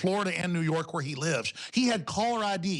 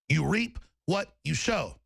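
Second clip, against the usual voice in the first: normal talking voice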